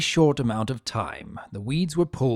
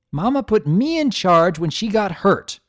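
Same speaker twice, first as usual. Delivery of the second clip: higher, louder, indignant; angry bossy filly; 8 semitones higher, 6 dB louder than usual. A narrator's reading. Older sister insisting she is in charge, loud and indignant. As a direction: stamp your authority.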